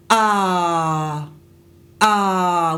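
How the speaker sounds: hard glottal onset on ah; pressed throat, ah hits hard; glottal attack. Shown as a demonstration. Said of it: "we don't want that".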